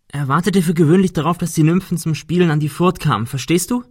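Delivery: urgent storytelling. A narrator's reading. Ben explains a myth to injured Cecil, urgent. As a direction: tell it insistently to hold his attention.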